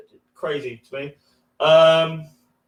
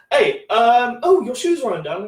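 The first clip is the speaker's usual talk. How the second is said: mock old lady; 5 semitones higher, 3 dB louder than usual. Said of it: "Anyway, she goes".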